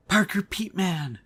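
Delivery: gasp, then a slow, high, starstruck name; thrilled to be noticed by his hero; delighted gasp. Retold or imitated. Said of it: "they show how happy he is right now".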